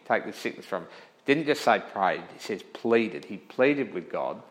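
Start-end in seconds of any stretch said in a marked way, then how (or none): 0.1-0.9 softer, lower plea, fading; a hushed second plea; softer pleading repeat. Retold or imitated. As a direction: beg it again, quietly. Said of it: "Paul had pleaded three times".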